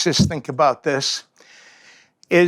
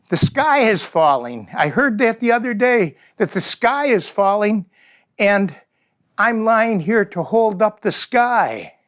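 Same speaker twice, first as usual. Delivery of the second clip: earnest little bird, raised pitch; a small creature explaining itself, higher; the little bird answering the raptor, lightly higher; 6 semitones higher than usual. Acted. Little bird voiced in parable.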